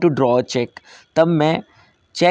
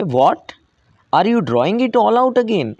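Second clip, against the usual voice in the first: higher-pitched astonished question; surprised exclamation; 6 semitones higher, much slower than usual. A narrator's reading. Clerk questioning a customer withdrawing everything, surprised. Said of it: "वो exclaim किया उसने surprising वे में".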